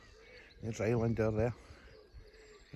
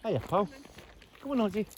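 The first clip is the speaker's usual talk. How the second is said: higher sing-song dog talk; coaxing the dog; 10 semitones higher than usual. Real, not acted. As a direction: coax the dog to come along.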